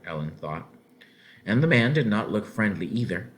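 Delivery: usual speaking voice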